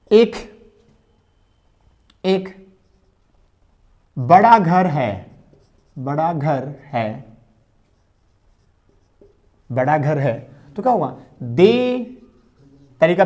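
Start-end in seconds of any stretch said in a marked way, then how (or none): 0.1-7.2 drawn-out, deliberate phrase; slow dictation. Shown as a demonstration. Teacher dictating to children, slow and deliberate. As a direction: spell बड़ा घर है out slowly for students. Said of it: "मैंने कहा".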